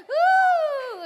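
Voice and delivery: long, high, loud "woo"; cheering whoop. Real, not acted. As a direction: whoop to pump up the crowd. Hyping up the quiz audience. (mataas at malakas na hiyaw)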